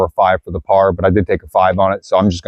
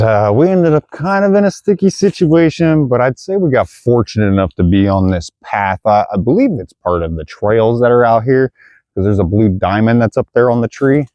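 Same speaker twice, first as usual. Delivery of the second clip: ordinary talk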